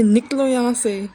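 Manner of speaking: slow, drawn-out mock yell; retold shout. Grandmother ordering them out of the house, drawn-out retold shout. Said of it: "चिल्ला के बोलती है".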